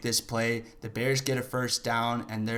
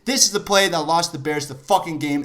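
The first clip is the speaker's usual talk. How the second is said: higher and louder, bitter repeat; angry; 4 semitones higher, 6 dB louder than usual. Real, not acted. Fan restating the losing play, now angry and louder. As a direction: spit it out in disgust.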